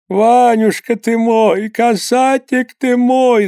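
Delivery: высоко и медленно, старушечьи причитания; протяжно запричитай старческим голосом; причитание старухи. A narrator's reading. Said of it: "запричитала она". (мать причитает над вернувшимся сыном, протяжно)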